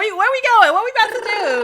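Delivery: high, loud, excited squeal; voicing her body as an eager girlfriend. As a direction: bounce with eager glee. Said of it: "She'd be like".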